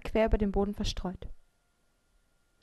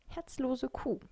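slightly grumbly inner insult, higher pitched; mild grumbling; 4 semitones higher, 4 dB quieter, slower than usual. A narrator's reading. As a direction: grumble it lightly to yourself. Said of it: "dachte ich grummelnd". (leise grummelnd gedacht)

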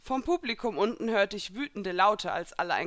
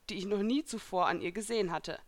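plain reading voice

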